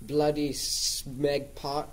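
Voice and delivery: very slow mock British insult; mock British accent. Playing a British gunman, mock British accent. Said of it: "I don't think he's gonna be British".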